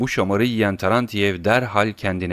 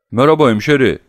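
loud, higher-pitched unfriendly hello; hostile greeting; 5 semitones higher, 8 dB louder, faster than usual. A narrator's reading. Tarantiyev greets Oblomov with a nasty look, loud and hostile. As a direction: throw the greeting out hard and loud. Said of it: "kötü bir bakışla".

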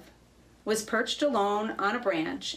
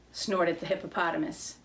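usual speaking voice

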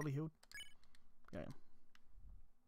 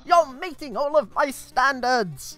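excited, flustered skeleton with high standards; flustered Papyrus; 6 semitones higher, 4 dB louder than usual. Acted. Papyrus reacts to the human flirting, flustered and excited. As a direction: gush and fluster over the flirting.

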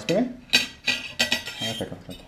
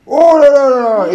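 mock angry mom; 11 semitones higher, 9 dB louder than usual. Imitating angry mom for child.

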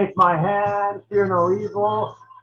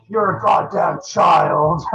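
slow, louder creepy voice talking down; evil voice; 5 dB louder, slower than usual. Retold or imitated. Album intro's evil voice scolding the kid, slow and menacing. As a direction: drag it out like a sinister demon mocking a kid. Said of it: "the evil voice is like".

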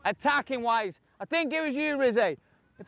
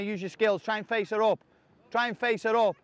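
usual speaking voice

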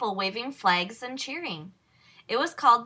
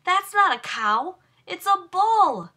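alarmed realisation; 8 semitones higher, 5 dB louder than usual. A narrator's reading. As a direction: spell out the danger in alarm. Realising the bull.

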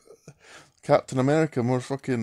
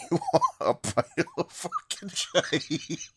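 laughing; 3 semitones higher, 4 dB quieter, slower than usual. Real, not acted. Laughing dismissal of the character.

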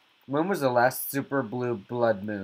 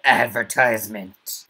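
mock announcer; 7 dB louder than usual. Announcing ad like a jingle.

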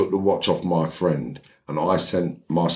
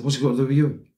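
high-pitched defensive comeback; indignant retort, pitched up; acted retort; 8 semitones higher, much faster than usual. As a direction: play the other man brushing off a challenge. Other man retorts in quarrel.

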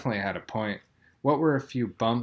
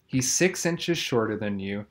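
normal talking voice

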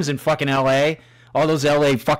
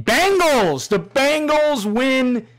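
much higher, slow, drawn-out victory call; triumphant cheer; 11 semitones higher, much slower than usual. Real, not acted. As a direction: call it like a sportscaster. Cheering his team's win.

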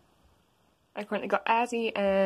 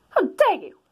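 much higher, louder offended mother; outraged mom; 10 semitones higher, 4 dB louder than usual. Acted. Toriel to rude son, high outraged. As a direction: gasp it out offended.

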